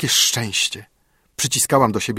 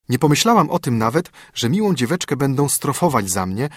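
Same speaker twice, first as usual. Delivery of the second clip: plain reading voice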